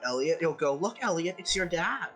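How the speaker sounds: ordinary talk, nothing marked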